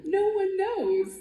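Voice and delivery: high, slow, drawn-out "No one knows"; playful hammed-up mystery; mock mysterious. Dramatic mock-mysterious line, then repeated plainly.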